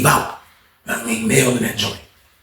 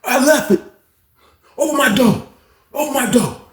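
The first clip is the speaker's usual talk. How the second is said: higher, insistent repeated order; impatient demand; 9 semitones higher than usual. Retold or imitated. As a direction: push it high, wanting that door open now. Mel demanding door open.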